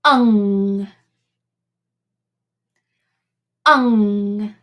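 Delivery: "ung" held in the nose; isolated ng sound. Shown as a demonstration. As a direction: hum "Ung" at the back. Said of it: "the last sound today is".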